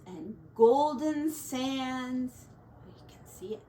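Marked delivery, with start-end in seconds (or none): none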